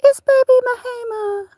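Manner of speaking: squeaky little dog voice; high pet voice. Pretend. Owner speaking for her dog in a high voice.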